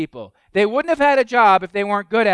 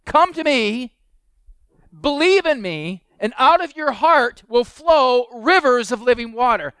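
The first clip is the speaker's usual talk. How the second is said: higher, bold proclamation; preacher voicing Jesus inviting the crowd, higher and bold; 4 semitones higher, 4 dB louder than usual. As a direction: call out the invitation boldly. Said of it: "the person says".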